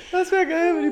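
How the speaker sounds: through loud laughter; words squeezed out laughing. Real, not acted. Laughing hard while speaking. (lachend gesprochen)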